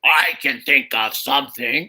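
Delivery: low creature voice; talking raptor. Acted. Raptor reveals it can talk.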